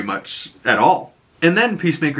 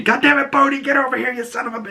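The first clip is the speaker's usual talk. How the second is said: mock angry yelling; 14 semitones higher, faster than usual. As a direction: imitate an owner yelling at a dog. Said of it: "as soon as I walk over there angry".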